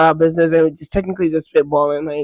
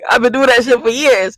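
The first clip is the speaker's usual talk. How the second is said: indignant; 6 semitones higher, 4 dB louder than usual. Retold or imitated. Indignant inner thought quoted.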